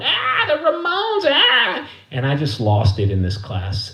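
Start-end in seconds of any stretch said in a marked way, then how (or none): 0.0-1.9 very high, drawn-out mock squeal; stretched-out falsetto teasing; taunting schoolgirls. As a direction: imitate two girls shrieking a taunt. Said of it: "these two girls just started going".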